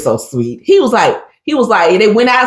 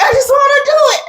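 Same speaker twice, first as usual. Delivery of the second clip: high-pitched imitated outburst; mock exasperation. Imitating James annoyed with his parents, high exasperated whine. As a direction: imitate a fed-up son, voice shooting up high. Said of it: "they got on his nerves".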